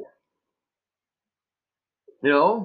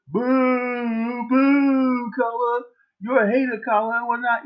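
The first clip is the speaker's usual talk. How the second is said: high mocking jeer at Colin; sneering wrestling villain; 7 semitones higher, slower than usual. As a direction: play the booing crowd, sneering. Mocking his critics booing him.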